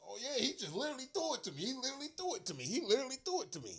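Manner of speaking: fast, high, gloating mimicry; mocking a Bengals player bragging on the sideline; mocking the bragger. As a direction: rattle it off like a smug receiver. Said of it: "kept having his arms out like".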